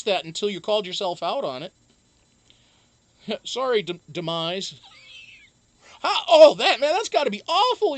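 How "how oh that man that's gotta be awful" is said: high, loud laughing, fast. Real, not acted. Streamer cracking up over a misspelled viewer name. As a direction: burst out laughing mid-sentence.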